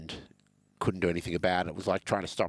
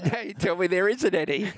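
laughing mock outrage, much higher; 11 semitones higher, 3 dB louder than usual. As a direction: cackle through a joking complaint.

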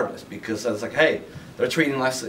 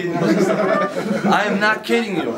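said through real laughter, higher; laughing; 6 semitones higher than usual. Real, not acted. Telling the crowd about five hundred copies, laughing. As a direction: laugh while insisting it's true.

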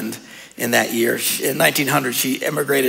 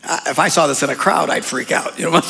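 normal talking voice

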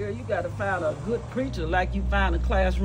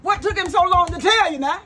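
high, loud disbelief; incredulous; 7 semitones higher, 5 dB louder than usual. Acted. Mother-in-law questioning the late confession, incredulous. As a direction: fire the question back in astonishment. Learns husband told her only today.

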